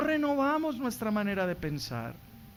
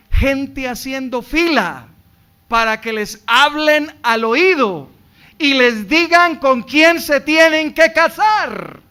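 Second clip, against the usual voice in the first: loud, higher preaching; preaching at full force; 8 semitones higher, 16 dB louder than usual. Acted. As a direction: drive it home to the congregation. (predicación a todo pulmón)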